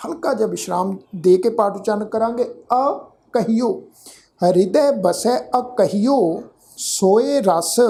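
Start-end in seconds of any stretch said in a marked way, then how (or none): 2.4-6.4 ਹਿਰਦੈ ਬਸੈ ਅਕਹਿਓ with a light pause before ਅਕਹਿਓ; slight break after ਬਸੈ, then ਅਕਹਿਓ. Shown as a demonstration. Teacher shows the sangat where to pause, with a light pause. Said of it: "ਇੱਥੇ ਹਲਕਾ ਜਿਹਾ ... pause ਦੇਣਾ ਹੈ".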